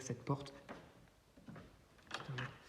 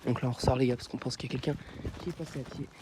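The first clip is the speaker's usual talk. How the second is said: ordinary delivery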